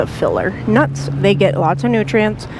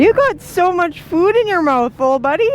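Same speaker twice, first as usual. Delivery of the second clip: talking to a pet; 8 semitones higher than usual. Real, not acted. Cooing at the crow.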